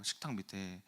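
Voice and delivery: ordinary talk, nothing marked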